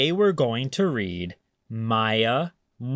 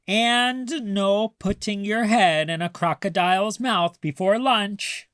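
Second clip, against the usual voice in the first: higher, teasing grandma voice; Grandma's playful voice; 8 semitones higher than usual. A narrator's reading. Grandma coaxing grumpy Maya, high and teasing. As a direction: sell the silly idea like a sly grandma.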